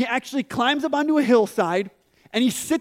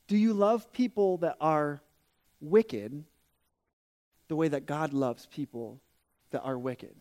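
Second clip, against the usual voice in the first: soft earnest plea; 5 semitones lower, 4 dB quieter than usual. Real, not acted. Pastor's earnest challenge.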